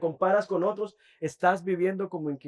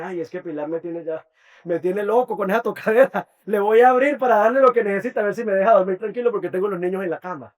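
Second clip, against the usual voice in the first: fed-up grumbling that builds louder and faster; a neighbor nagged awake, getting louder as he gives in; exasperated neighbor; 2 semitones higher, 7 dB louder, faster than usual. Acted. Sleepy neighbor fed up with knocking, rising to a loud, fast complaint.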